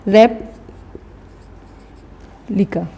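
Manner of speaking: long pause between रेप and लिका; syllable break. Shown as a demonstration. Teacher writing two syllables, रेप and लिका said far apart. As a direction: say रेप, wait, then लिका. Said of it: "पहले हम रेप बोलेंगे फिर लिका".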